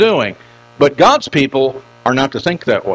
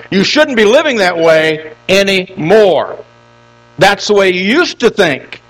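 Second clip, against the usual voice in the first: put-on raised, insistent preaching of the old ways; firm reminder; 5 semitones higher, 4 dB louder than usual. Paul reminding believers of their old ways, raised insistent preaching. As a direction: press each line harder, building to a high peak. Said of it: "He's reminding them".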